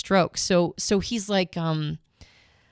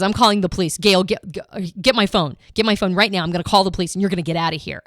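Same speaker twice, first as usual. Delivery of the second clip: louder, fast, furious father shouting; retold angry yell; 4 dB louder, faster than usual. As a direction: bark it like an enraged dad throwing her out. Father yelling threats at daughter.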